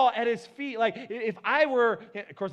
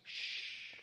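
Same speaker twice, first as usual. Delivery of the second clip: long breathy shush; drawn-out "shh"; 15 dB quieter than usual. Retold or imitated. Hushing his loud little daughter.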